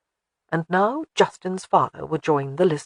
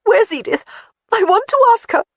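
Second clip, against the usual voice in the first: much higher, louder agitated question; distressed demand; 7 dB louder than usual. Acted. Jamie demanding to know where Edith is, high and agitated. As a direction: press urgently, voice rising.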